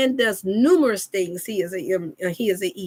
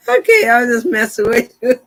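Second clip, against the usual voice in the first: amused dad; 8 dB louder than usual. Pretend. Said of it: "he like".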